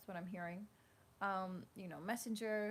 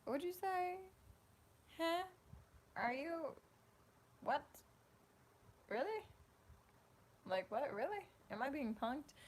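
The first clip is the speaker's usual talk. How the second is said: high, incredulous huh and really; playing the stunned listener, high and questioning; imitating the viewer's shocked reaction; mock disbelief; 9 semitones higher than usual. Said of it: "you're gonna be like".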